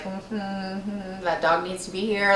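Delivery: muted, doubtful mm-hmm; hushed, pointed humming at someone; silent side-eye. Retold or imitated. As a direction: murmur it under your breath, unconvinced. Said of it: "giving him kind of looks".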